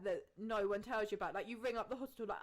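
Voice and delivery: ordinary talk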